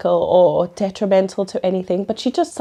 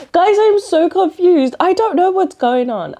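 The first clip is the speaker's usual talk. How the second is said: exaggerated confusion; 9 semitones higher, 4 dB louder than usual. Real, not acted. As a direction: play up how lost you are. Overwhelmed by confusing book plot.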